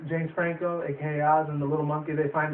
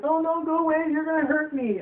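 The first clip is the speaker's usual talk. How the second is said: put-on squeaky high-pitched frightened little girl; high scared girl voice; 12 semitones higher than usual. Retelling the China girl pleading, high and frightened. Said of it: "He's like".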